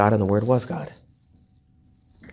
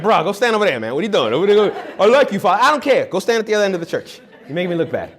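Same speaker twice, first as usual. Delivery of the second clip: joking bro banter; 7 semitones higher, faster than usual. Retold or imitated. Priest ribbing a muscular guy beside him, mock complaint. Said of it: "I was like".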